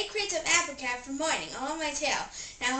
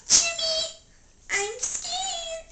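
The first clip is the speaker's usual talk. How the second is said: scared high whimper; 3 dB louder, much slower than usual. Acted. Scared, calling his friend.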